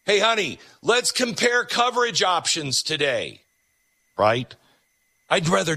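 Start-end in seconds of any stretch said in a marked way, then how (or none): 0.0-3.4 high chirpy mock voice; sing-song like it's fun; hammed-up eager husband; mock cheerful spouse. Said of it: "nobody wakes up ... thinking".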